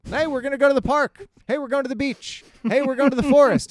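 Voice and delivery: high, loud, eager doggy-talk voice; golden retriever owner. Retold or imitated. Dad talks to his son like a golden retriever, high and eager. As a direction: sound like you're talking to an excited dog. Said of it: "It's like talking to a golden retriever".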